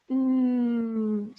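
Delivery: Mm hummed with closed lips; a contented Mm; m sound. Acted. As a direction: hum Mm as if tasting cheese. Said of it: "she says".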